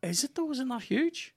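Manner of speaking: sharp high disbelieving questions; surprised. Real, not acted.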